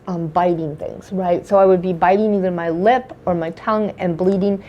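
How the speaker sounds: normal talking voice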